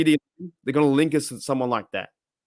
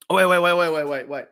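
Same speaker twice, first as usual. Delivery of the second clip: excited wait; 3 semitones higher, faster than usual. Real, not acted. Excited reaction to fireworks.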